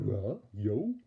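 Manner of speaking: stammering cartoon dog voice; hesitant goofy dog stammer; Scooby-Doo. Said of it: "Dog stammering like Scooby-Doo".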